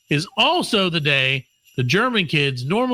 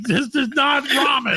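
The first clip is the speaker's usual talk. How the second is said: high, drawn-out mock yell; mock outburst; 9 semitones higher than usual. Retelling an outraged reaction to ramen, mock yell. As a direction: yell it high, dragging the words out. Acted retold outraged line.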